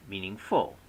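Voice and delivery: "meaningful" stressed on FUL; wrong final stress. Shown as a demonstration. Lecturer showing students another misplaced stress, mean-ing-FUL. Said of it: "syllable that we say louder".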